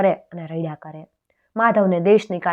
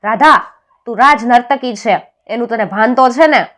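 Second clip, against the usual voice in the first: loud, raised voice of an outraged king; king's angry shout; 4 semitones higher, 8 dB louder than usual. A narrator's reading. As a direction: thunder it as a sharp rebuke. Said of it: "રાજા બૂમ પાડીને બોલ્યા". (ગુસ્સે ભરાયેલા રાજાની બૂમ)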